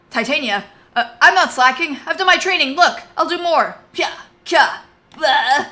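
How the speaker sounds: put-on young fighter cowering before his commander; panicked young fighter. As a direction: blurt excuses in a rush. Boyd panics before Titania.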